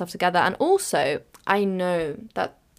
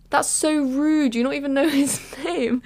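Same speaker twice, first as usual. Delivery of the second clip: high, amused mock outrage; imitated indignation; 7 semitones higher than usual. Friend telling off the teacher, laughing mock indignation.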